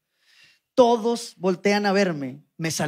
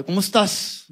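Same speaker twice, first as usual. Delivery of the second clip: ordinary talk, nothing marked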